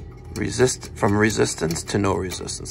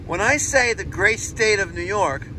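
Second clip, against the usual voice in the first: sarcastic; 8 semitones higher than usual. Shown as a demonstration. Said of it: "I'm being sarcastic".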